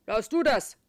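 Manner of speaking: loud gruff terrier; barking dog voice. A narrator's reading. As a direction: bark the words like a dog. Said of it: "bellt Charlie".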